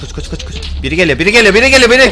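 whispered run, then panicked shouting; from hushed urgency to frantic yell; being chased. Real, not acted. Monster chases him in maze.

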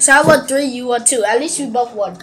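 normal talking voice

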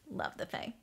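breathless whisper of adoration. Real, not acted. Fan confessing her love of the Fae in a whisper. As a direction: whisper it like a secret crush. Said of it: "I'm done fangirling".

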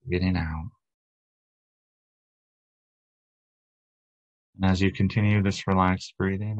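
calm, unhurried meditation guide; guided meditation. Acted. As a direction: lead them into relaxation, leaving long pauses between phrases. Leads guided breathing and relaxation meditation.

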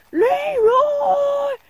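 loud, high charging yell; a big hammed-up battle cry; Leroy Jenkins battle cry. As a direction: scream it like charging in. Meme battle cry before charging.

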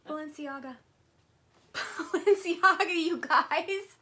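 laughing, then a loud high shout of the brand; laughing excitement. Real, not acted. Laughing loudly at finding a Balenciaga card holder. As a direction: laugh and squeal the name.

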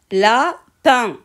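"Lapin" split by a pause, la-pin; syllable by syllable. Shown as a demonstration. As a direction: say "Lapin" in two drawn-out beats. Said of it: "Je découpe les mots de la fiche en syllabes". (« Lapin » découpé en syllabes, la-pin)

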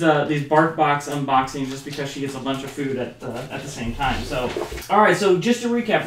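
ordinary delivery

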